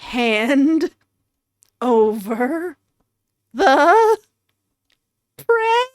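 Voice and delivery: word-by-word threat, climbing in pitch; menacing demand. Acted. Narrator giving last chance to hand over prey, mounting menace.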